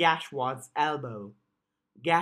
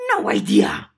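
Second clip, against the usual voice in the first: goblin voice; 9 semitones lower, 9 dB louder than usual. Acted. Goblin Cherry voiced in story.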